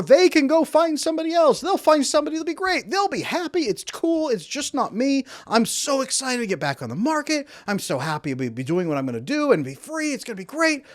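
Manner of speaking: high, airy imitation of a carefree ex; mock breezy avoidant. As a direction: toss it off like nothing matters. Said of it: "here's what an avoidant person is thinking".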